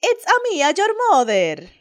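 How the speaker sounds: high Mario-style phone greeting; mock Mario voice. Mother answering her son's call as Mario. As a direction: prank your kid on the phone. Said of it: "cojo el teléfono y digo".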